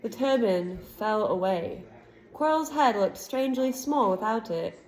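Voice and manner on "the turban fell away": plain reading voice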